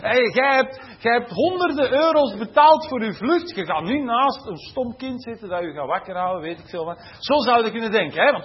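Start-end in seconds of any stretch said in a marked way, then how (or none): 0.1-5.5 higher and louder, huffy protest; a mock-outraged traveller insisting on his rights; indignant entitled passenger. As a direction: play the offended passenger who paid good money.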